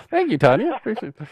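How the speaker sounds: high, sarcastic thank-you; mock thanks. Real, not acted. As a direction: thank her with a wry grin.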